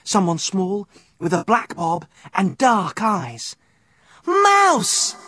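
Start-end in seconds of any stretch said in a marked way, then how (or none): 4.2-5.1 high joyful call of a name; crying out. Acted. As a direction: call out in happy surprise. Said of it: "he cried".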